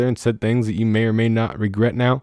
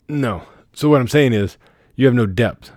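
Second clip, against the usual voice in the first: usual speaking voice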